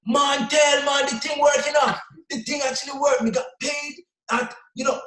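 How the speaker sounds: high excited shout, slowing down; fired-up shouting. Retold or imitated. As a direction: shout it out like you just got paid. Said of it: "He was so fired up".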